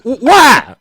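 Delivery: loud, high stammered question; alarmed disbelief. Retold or imitated. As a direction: blurt it out in acted alarm.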